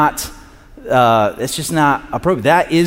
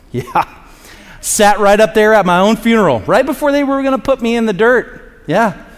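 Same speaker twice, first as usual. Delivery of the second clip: high, hammed-up boast; playful bragging; 8 semitones higher, 3 dB louder than usual. Revived man topping others' party stories, bragging loudly and high. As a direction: brag it up, pitch climbing well above normal.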